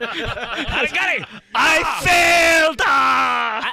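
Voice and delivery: slow, loud, strained death cry; hammed-up anguished dying shout; mock dying samurai. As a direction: ham up falling on your own sword. Acting out falling on a sword.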